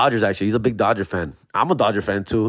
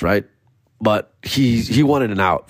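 usual speaking voice

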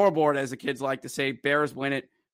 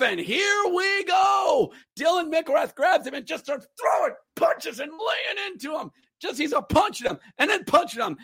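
much higher, shouting play-by-play; excited fight call; 12 semitones higher than usual. Real, not acted. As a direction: call the fight like it's the best moment of the night.

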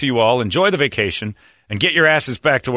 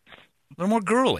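put-on higher, lightly girly; 10 semitones higher than usual. Shown as a demonstration. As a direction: push the pitch up girly. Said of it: "push it up a little".

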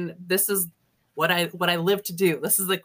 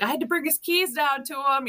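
teasing mockery; 7 semitones higher than usual. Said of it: "she's making fun of him".

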